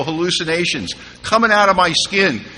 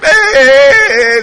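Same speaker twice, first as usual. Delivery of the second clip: loud, high drawn-out yell; calling out; 10 semitones higher, 9 dB louder than usual. Retold or imitated. Imitating neighbors of a naked man, hollered.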